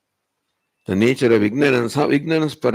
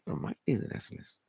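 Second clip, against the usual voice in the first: breathy whispered aside; softly whispered correction; 3 semitones lower, 16 dB quieter than usual. Acted. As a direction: whisper it like a secret. Teacher's hushed aside to the disciple.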